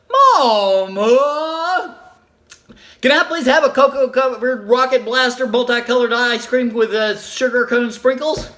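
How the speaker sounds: put-on high, drawn-out begging child voice; pleading little child. Little girl begging her mom for cookies, drawn-out pleading. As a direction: stretch it out like a small kid begging.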